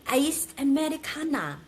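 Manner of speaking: Iced Americano said in a Korean accent, high and lifted; Korean-style pronunciation. Shown as a demonstration. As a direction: order it like a Korean cafe customer, Korean accent. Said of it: "You have to say it like a Korean".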